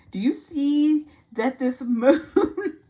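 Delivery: high, loud and laughing through the words; excited laugh breaking into the line. Real, not acted. Tarot reader laughing with delight at the card.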